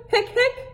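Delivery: hiccup sounds, very high; squeaky hiccups. Acted. Hector hiccups.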